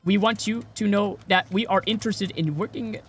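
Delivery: hammed-up scam recruiter reading lines; scam caller. Mocking a scam recruiter calling him, halting and unsure. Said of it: "they sound off".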